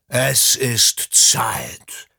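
slow, rasping near-whisper of an ancient beast; deep throaty sea serpent. A narrator's reading. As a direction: breathe it out like a huge old sea creature. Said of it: "dröhnte er mit seiner tiefen, kehligen Stimme".

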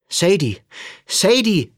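two loud, high calls, the second rising further; insistent calling. A narrator's reading. Sam calls after Sadie, who hasn't heard him, insistently. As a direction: keep calling to get her attention.

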